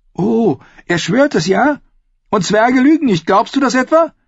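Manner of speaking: high, loud, derisive woman's voice; mocking sarcasm. A narrator's reading. Sister mocks brother's faith in Tyrion, sarcastic. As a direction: throw the oath back with angry sarcasm.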